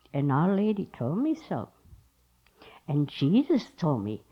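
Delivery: hushed, earnest mock testimony; innocent devotee. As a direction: imitate a wide-eyed innocent, low and soft. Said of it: "with these big blue eyes, these big innocent blue eyes".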